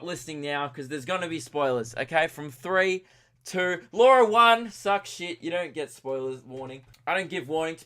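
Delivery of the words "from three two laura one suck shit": loud, high countdown building to a yell; gleeful blurted countdown. Real, not acted. Podcaster spoiling finale for listeners, loud taunting shout. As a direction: blurt the spoiler loudly, stretching the end.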